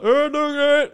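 high, loud mock heckling; heckler behind reporter. Bystander interrupting a reporter's news piece, high-pitched heckling. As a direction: squawk like a camera-crashing idiot. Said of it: "someone's like".

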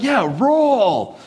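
"rural" as one long high "roll"; wife's slurred rural. Retold or imitated. Wife insisting she said it right, slurred "rural". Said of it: "the way my wife says rural".

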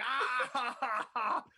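high, loud imitation howl of laughter. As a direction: howl "ha, ha, ha" in mock hysterics. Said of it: "when I'm howling".

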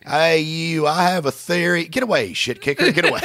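hammed-up shout of a brainy man shooing someone off; Albert Einstein. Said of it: "Albert Einstein would be like".

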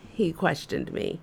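ordinary talk

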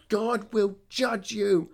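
loud, high, slow mock condemnation; drawn-out dramatic shriek, retold; wrathful curse. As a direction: imitate her pronouncing doom. Retold screaming customer's curse.

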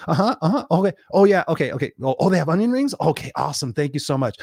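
hammed-up eager phone answers; distracted coaching client. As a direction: play a client interrupted by a phone call. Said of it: "he called me, like".

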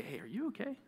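soft, hesitant, caring check-in; gentle concern. Acted. Friend who was snapped at, gently offering to talk and pray. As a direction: ask softly, feeling your way toward peace.

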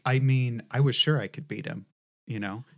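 usual speaking voice

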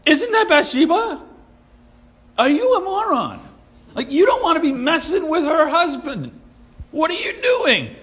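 high-pitched, exasperated disbelief; incredulous outburst. Acted. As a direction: challenge him as if he's being an idiot, voice way up. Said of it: "I don't read it like".

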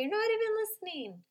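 high, put-on kid voice accusing; child's whine, calling you out; whiny kid protesting; child complaining. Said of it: "catch you out and say".

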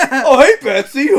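high and loud, cracking up through the line; a goofy high bewildered voice, laughing; the friend baffled at waking up there, squeaked out through laughter; laughing Doug impression. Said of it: "He's like".

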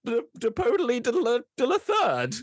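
high, pompous noble voice; a grand noble demanding the supervisor; haughty noble voice. Acted. Player character to goblin clerk, pompous high noble voice. Continues noble name flourish.

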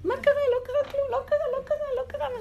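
high, loud singsong soothing; mock comforting to upset kids; animated reassuring. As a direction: brush it off like it's nothing, high and bright. Acting soothing kids loudly.

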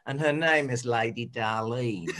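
slow, laughing Australian accent. Shown as a demonstration. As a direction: say the name like an Australian. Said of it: "if you can say in an Australian accent".

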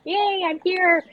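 high, slow giddy cheer; beaming with first-game joy; excited cheer. Retold or imitated. Recalling grad school arrival, high excited squeal. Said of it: "I'm, like, so excited".

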